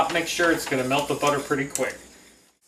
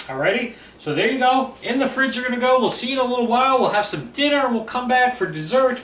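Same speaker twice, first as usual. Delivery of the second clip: high playful sing-song voice; chirpy singsong farewell to the pudding; 9 semitones higher, 4 dB louder than usual. Real, not acted. Talking to pudding in fridge.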